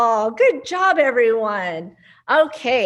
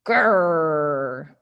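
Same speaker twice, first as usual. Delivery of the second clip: low playful growl; mock growl; 7 semitones lower than usual. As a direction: growl like a pretend tiger. Mouse growls like tiger.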